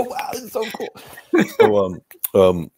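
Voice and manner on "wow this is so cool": high, awed hush of a starstruck fan; starstruck awe. Retold or imitated. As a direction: breathe it out, amazed. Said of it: "I go".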